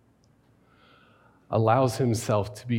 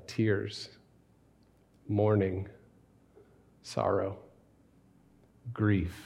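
solemn litany; 6 dB quieter, slower than usual. Acted. Sermon litany of grief.

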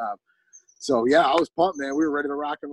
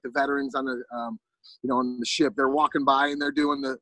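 usual speaking voice

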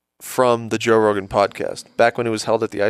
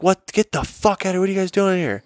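mock outraged employee, pitched up; retold angry yell; 8 semitones higher than usual. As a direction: bark it like a startled worker catching intruders. Employee busts them smoking.

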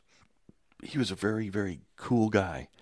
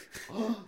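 surprised gasp; 14 semitones higher, 7 dB quieter than usual. Retold or imitated. Starstruck reaction to hearing voice.

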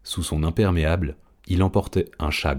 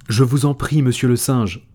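pleading; 7 semitones higher, 5 dB louder than usual. A narrator's reading. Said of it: "supplia Afortunada".

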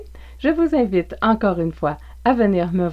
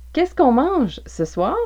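ordinary talk, nothing marked

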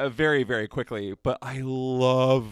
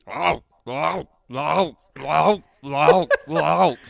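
repeated wordless chomping "owl" noises, getting louder; looped dog chewing noise; 3 semitones higher, 7 dB louder, slower than usual. Retold or imitated. Host mimicking the looped clip of a dog chewing a treat, chomping noises. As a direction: imitate a dog's mouth on a short chew loop. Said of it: "if you were to have the real sound".

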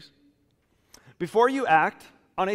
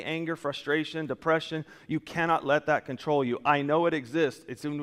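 ordinary delivery

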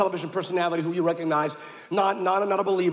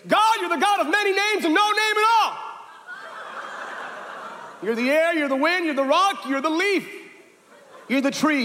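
high, airy mock piety; mocking a vague prayer; 9 semitones higher than usual. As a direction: float each line up like a new-age prayer. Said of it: "she prayed, and she went".

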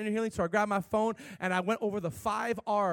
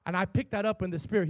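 ordinary delivery

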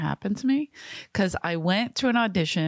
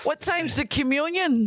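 mock kid; 9 semitones higher than usual. Voicing tomboy kid.

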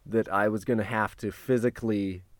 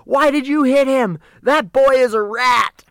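high, loud outraged shriek; screaming taco lady; 13 semitones higher, 8 dB louder than usual. Retold or imitated. Taco lady screams at him for shoving the man, outraged shriek. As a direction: imitate an angry vendor screaming. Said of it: "starts screaming at me in Spanish".

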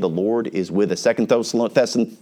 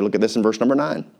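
normal talking voice

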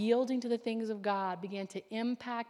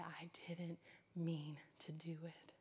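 hushed whisper; 5 semitones lower, 13 dB quieter, slower than usual. Retold or imitated. Whispered confession retold.

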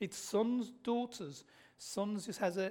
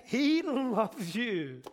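loud, high, stretched-out proclamation; emphatic climax; 3 semitones higher, 6 dB louder, much slower than usual. Real, not acted. Preacher assuring congregation of God's love, loud climactic cry. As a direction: hit it hard and draw each word out.

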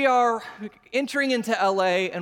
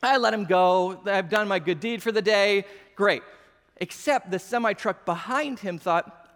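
ordinary talk, nothing marked

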